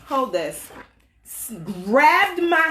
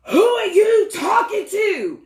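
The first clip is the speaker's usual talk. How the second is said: mock angry husband; 9 semitones higher, 5 dB louder, slower than usual. Husband spins around angry.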